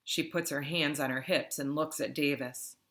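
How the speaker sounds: plain reading voice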